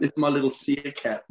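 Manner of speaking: laughing through the words. Real, not acted. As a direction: giggle it out. Laughing at cat.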